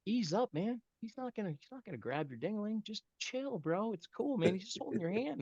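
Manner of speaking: high, soft, coaxing voice; imitated soothing buddy. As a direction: gently talk a nervous pal down. Kid calming down embarrassed friend.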